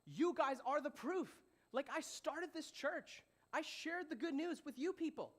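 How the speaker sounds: high, drawn-out exasperated insistence; emphatic retort. Acted. Paul answering doubters who question his apostleship, emphatic retort. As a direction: push each word up, spelling out the obvious. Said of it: "Paul is saying to them, "Hey, listen".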